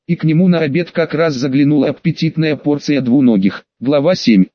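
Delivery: usual speaking voice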